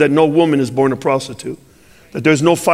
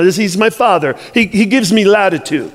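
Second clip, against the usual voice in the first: usual speaking voice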